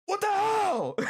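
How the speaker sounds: high-pitched incredulous outburst; exasperated outburst. Real, not acted. Streamer venting to viewers about never getting clips, exasperated outburst. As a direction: cry out in exasperated disbelief.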